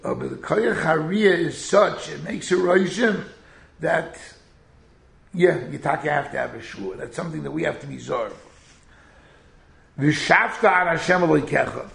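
usual speaking voice

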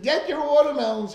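put-on the boy answering with the seller's cry; kid blurting the call. Child answering his lesson, mock vendor call. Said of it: "teach him Torah".

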